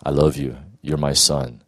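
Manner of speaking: God speaking to his son, low and tender; God's tender voice. Retold or imitated. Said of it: "He said".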